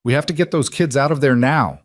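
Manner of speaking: hurry, it's burning; urgent. A narrator's reading. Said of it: "Tom said urgently".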